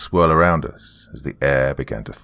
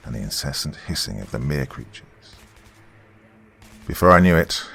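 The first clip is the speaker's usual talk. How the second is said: plain reading voice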